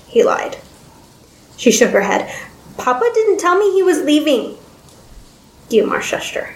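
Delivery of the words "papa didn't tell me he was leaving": put-on high, childlike protest; stubborn little girl's voice; upset young girl. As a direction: object in a high girlish voice.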